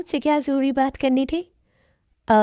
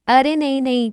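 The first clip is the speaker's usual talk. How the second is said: hasty exclamation; 3 semitones higher, 6 dB louder than usual. Acted. Quick denial of a fight.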